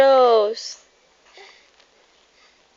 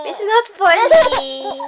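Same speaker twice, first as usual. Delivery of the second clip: higher, louder, laughing through it; anger turning to laughter; 7 semitones higher, 4 dB louder than usual. Real, not acted. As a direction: scold but crack up. Scolding while laughing.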